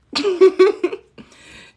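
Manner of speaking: high, loud laughing fit; loud burst of laughter. Real, not acted. Laughing at viewers over the French keyboard, loud burst of laughter. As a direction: crack up at her own mix-up.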